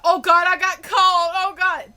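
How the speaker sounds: high, loud, panicky whining; mock panic. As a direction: mockingly act out someone's dramatic panic. Mocking a panicked reaction.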